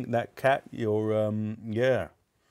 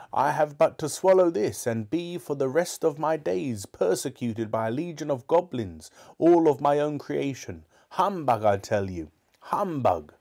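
put-on higher-pitched indignant old miser; scoffing Scrooge; 6 semitones higher than usual. Scrooge defying the ghost, scornful and indignant. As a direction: dismiss it all in a raised character voice.

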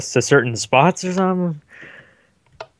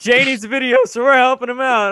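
voice crack; 8 semitones higher than usual. Real, not acted. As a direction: voice cracking up high. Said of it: "I, voice crack".

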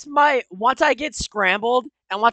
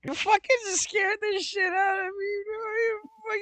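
high, softer squeal of relief after a scare; rattled protest; 7 semitones higher, 7 dB quieter than usual. Real, not acted. Player to the friend who pranked them, rattled high whine. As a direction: squeal the complaint, voice pitched way up.

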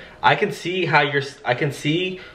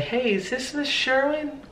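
softer, higher and slow friendly greeting; gentle; 12 semitones higher, 4 dB quieter, slower than usual. Shown as a demonstration. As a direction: ease in gently to lower the guard. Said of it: "lower your, become gentle".

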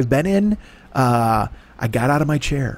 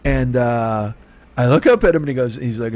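ordinary delivery

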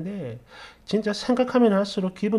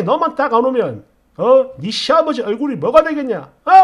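loud, raised-pitch indignant demands; scolding father-in-law; 6 semitones higher, 7 dB louder than usual. Acted. Father-in-law phones daughter-in-law over skipped grave visit, loudly scolding. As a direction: berate her as an offended father-in-law.

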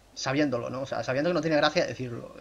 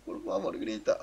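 low, soft begging; hushed plea; 5 semitones lower, 7 dB quieter, slower than usual. Pretend. Player begging the others to roar, quietly pleading. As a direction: drop to a defeated little plea. Pleading after nobody has roared.

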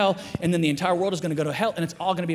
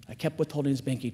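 ordinary talk, nothing marked

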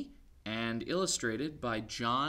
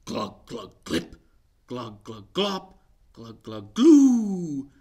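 building glugging noises; gloopy swamp sounds; 16 dB louder, slower than usual. Acted. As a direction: bubble like a swamp.

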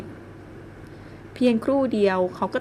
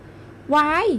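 high, drawn-out startled cry; startled shriek; 7 semitones higher, much slower than usual. A narrator's reading. Woman startled awake by a man, high shriek. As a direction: she squeals on waking to a face. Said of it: "หล่อนอุทานเสียงดังลั่น".

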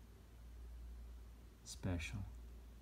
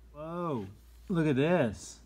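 amazed; 7 semitones higher, 6 dB louder, slower than usual. Real, not acted. Marvelling at the picture.

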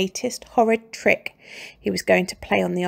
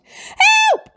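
screaming; 8 dB louder than usual. A narrator's reading. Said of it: "screamed Missus Twit".

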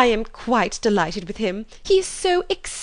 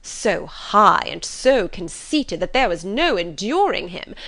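bitter indignation; 2 semitones higher, 4 dB louder than usual. Acted. Mother complaining of Darcy to husband, indignant. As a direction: huff with exaggerated outrage. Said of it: "with much bitterness of spirit and some exaggeration".

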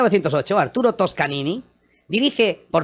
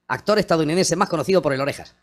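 usual speaking voice

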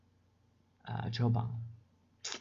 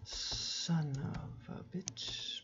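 whispered swear; 4 semitones higher, 9 dB quieter, much slower than usual. Shown as a demonstration. Quietly voicing a swear word.

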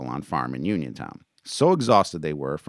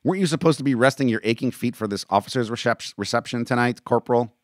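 loud, raised-pitch dressing-down; sneering; 7 semitones higher, 5 dB louder than usual. A narrator's reading. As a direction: sneer at the corporal, loudly.

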